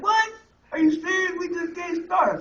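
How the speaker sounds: the friends can't believe he's leaving; surprised protest. Retold or imitated.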